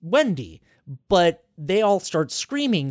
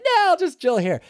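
casual brush-off; 11 semitones higher, 3 dB louder than usual. Retold or imitated. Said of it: "she's like".

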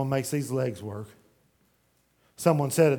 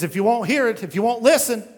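louder and higher stern warning; 7 semitones higher, 7 dB louder than usual. Acted. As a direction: warn them sharply. God's warning quoted.

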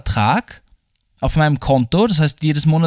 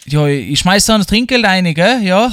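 higher, louder Styrian waitress impression; imitating the waitress; 5 semitones higher, 4 dB louder than usual. Imitating the waitress when asked how she said it, higher and louder. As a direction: lightly mimic the local waitress's Styrian lilt. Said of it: "how did she say it".

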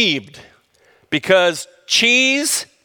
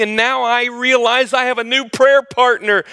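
normal talking voice

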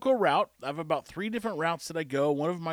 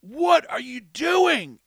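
loud, higher, slowed-down yell of "Hey, what are you doing"; calling out; 7 semitones higher, 7 dB louder, much slower than usual. Retold or imitated. [name] running over to stop the dog fight, loud drawn-out call. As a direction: stretch it out as you break up a fight. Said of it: "and I go".

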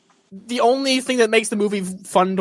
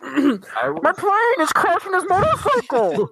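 slow, high, put-on Bane voice; Bane impression; 8 semitones higher than usual. Podcaster imitates Bane from The Dark Knight Rises, slow put-on voice. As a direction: growl it out like the masked villain, slowly. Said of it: "he did the voice of Bane".

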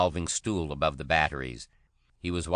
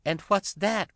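much higher, shrill alarmed cry; 3 dB louder, slower than usual. A narrator's reading. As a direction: shriek it up in alarm. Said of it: "cried Madge in a shriller voice".

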